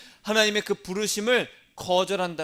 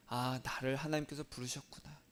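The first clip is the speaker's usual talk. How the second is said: softer, inward, to oneself; quiet realization; 3 semitones lower, 11 dB quieter than usual. Shown as a demonstration. Pastor models a private realization before the congregation repeats. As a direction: murmur it like a sudden realization. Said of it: "따라하겠습니다".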